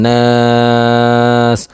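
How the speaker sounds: নাস with the long aa held for several beats; drawn-out naas. Shown as a demonstration. Quran teacher stretching the vowel when pausing at the end. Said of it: "ওয়াকফ করিলে".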